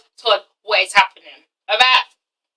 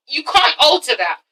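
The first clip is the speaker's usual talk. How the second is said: ordinary delivery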